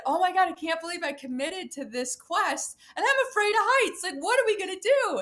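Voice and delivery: high, fast, half-laughing panic; panicked disbelief. Retold or imitated. Climber retelling her panic to her guide, high and fast. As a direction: babble like you're scared of heights. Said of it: "And I said".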